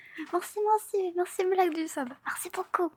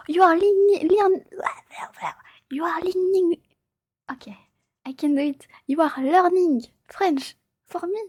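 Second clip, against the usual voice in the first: playful loud English; 6 semitones higher, 7 dB louder than usual. Acted. Mock English at viewer.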